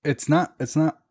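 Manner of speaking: talking around something in the mouth. Real, not acted. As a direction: speak with a stray hair on the tongue. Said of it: "sorry, I have something in my mouth".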